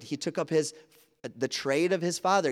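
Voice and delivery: ordinary talk, nothing marked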